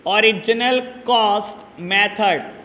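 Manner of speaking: "Original cost method" slowly, a gap between words; dictation. Shown as a demonstration. Teacher repeating another name for students, slow dictation.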